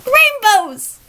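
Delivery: high, loud mock-gushing squeal over rainbows; mocking shallow ally. Vlogger mocks someone who only celebrates in June, squealing. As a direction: gush like a bandwagon ally. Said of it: "that person that just says".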